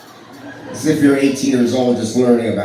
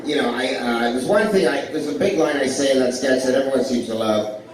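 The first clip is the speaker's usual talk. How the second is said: ordinary delivery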